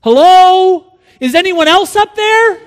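very high, loud hopeful call; comic high call upward; piping up. Acted. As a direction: pipe up hopefully for another rescuer. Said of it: "the man piped up again".

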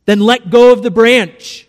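lightly acted voice of authority; commanding voice from above. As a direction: give a firm divine command.

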